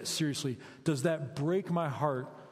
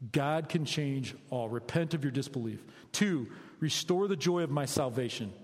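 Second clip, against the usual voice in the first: usual speaking voice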